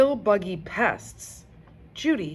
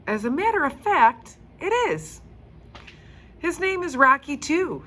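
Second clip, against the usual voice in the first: high, loud playful guide voice; delighted grown-up playing along; cheery guide; 10 semitones higher, 6 dB louder than usual. Stephanie and Frank answer.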